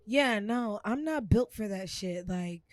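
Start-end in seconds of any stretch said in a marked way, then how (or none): none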